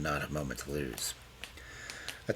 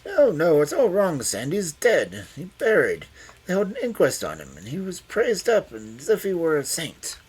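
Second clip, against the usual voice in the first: put-on high, loud, agitated old man; agitated protest; 10 semitones higher, 7 dB louder than usual. A narrator's reading. Old Craven protesting to Loveday, high and loud. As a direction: burst out in distress.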